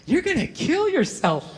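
put-on slow, high child voice; creepy little girl. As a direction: imitate an eerie child's slow pronouncement. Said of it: "she just nods and just goes".

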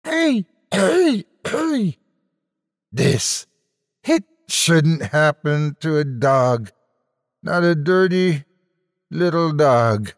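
very high, hoarse, slow lament; high hoarse sad voice. Acted. Weeping Venusian lamenting to the other Venusian, high hoarse and sad. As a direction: wail it out as a grieving creature. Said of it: "high-pitched, hoarse, sad voice".

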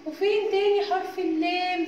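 ordinary talk, nothing marked

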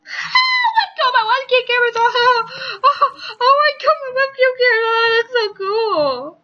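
high, loud near-crying shout of delight; thrilled shouting. Real, not acted. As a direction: yell it out high and loud, overjoyed. Thrilled webcam is on.

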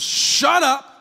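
annoyed shout. Retold or imitated. Pastor voicing Jesus scolding the weather, grumpy loud snap. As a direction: bark it like someone just woken up. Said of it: "super annoyed that he's getting woke up".

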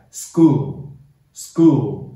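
"school" with the k voiced to a g after s; 浊化发音. Shown as a demonstration. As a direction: say "school" slowly, its c like a hard g. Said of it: "把清辅音变化成对应的浊辅音". (清辅音浊化，慢读示范)

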